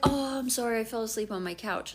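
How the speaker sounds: ordinary talk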